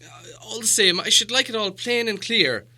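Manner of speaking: obstinate. A narrator's reading. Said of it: "obstinately, putting on his business manner".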